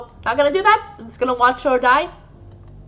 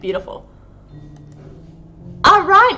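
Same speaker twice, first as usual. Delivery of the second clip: ordinary talk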